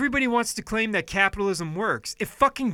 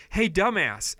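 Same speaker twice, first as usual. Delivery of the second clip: usual speaking voice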